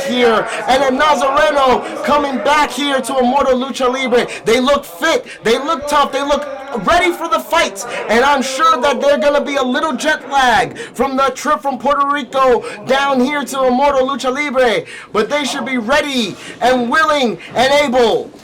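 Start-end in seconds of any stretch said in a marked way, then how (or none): none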